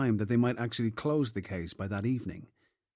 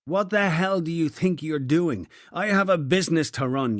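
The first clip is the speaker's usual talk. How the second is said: loud, higher angry rant; angry outburst; 5 semitones higher, 5 dB louder than usual. Acted. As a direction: rage at the detectives. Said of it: "O'Shaughnessy burst out".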